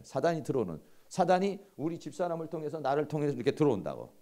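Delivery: ordinary talk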